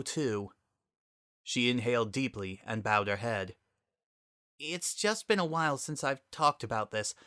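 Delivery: steel yourself to tell it; resigned Sunset. Acted. Sunset opens up.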